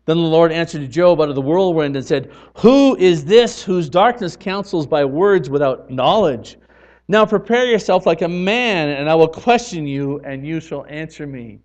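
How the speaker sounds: loud declamatory Bible reading; scripture reading. Acted. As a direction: proclaim the text to the congregation. Reads scripture aloud.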